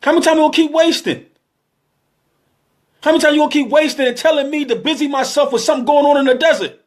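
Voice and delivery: high, urgent sermon voice, pushing fast in places; preaching at full force. Real, not acted. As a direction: hammer the congregation with each question.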